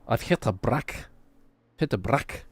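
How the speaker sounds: put-on Geordie accent, brick said as brack; Stephen Graham Geordie. Streamer imitating Stephen Graham's clip, Geordie accent. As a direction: do a fond Geordie impression of the line. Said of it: "That Geordie".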